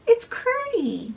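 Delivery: high-pitched, drawn-out cry of discovery; excited recognition. Retold or imitated. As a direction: lift it high, as if suddenly recognizing a friend. Princess recognizes the voice.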